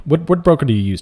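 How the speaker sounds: normal talking voice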